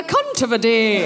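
put-on prim Edinburgh schoolmistress Scottish accent; a light Miss Jean Brodie Scottish accent; posh Scottish accent. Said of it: "my Miss Jean Brodie".

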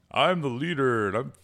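high, loud, silly turtle-leader voice; Leonardo impression. Co-host asks for the leader turtle, high hammed-up voice. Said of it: "And Leonardo? ... he'd be like".

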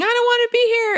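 put-on high, loud child's wail; screaming child. Parent voicing a child who won't stay, high screaming. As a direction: wail it like a toddler melting down. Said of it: "If your child is screaming".

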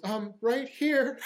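a lightly voiced meek "right here"; timid casting director.

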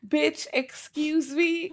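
high, slow, affronted "excuse me"; mock outrage. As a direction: drag it out, scandalized and offended.

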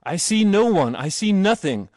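loud, high panicked cry; screamed. A narrator's reading. Terrified passenger seeing nothing outside, screamed. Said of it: "Mister Bond screamed".

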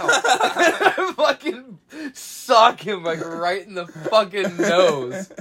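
slow, laughing through the words. Real, not acted. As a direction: laugh while telling it.

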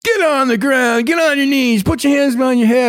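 imitating cop commands. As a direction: shout orders like a raid cop. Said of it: "all I can hear was".

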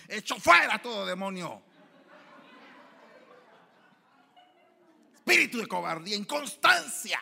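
loud, high preacher's rebuke; mock deliverance shout. Preacher casting out demons, a mock loud rebuke.

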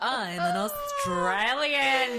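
loud, high, slow mock triumph; gloating comeback. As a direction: draw it out big and high, hammed-up gloating.

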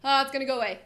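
about to sneeze. Real, not acted. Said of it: "I'm gonna sneeze".

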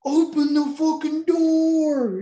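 very high, loud, slow drawn-out yell; hammed-up furious roar; desperate yell. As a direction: scream it at the plane door. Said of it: "he's like".

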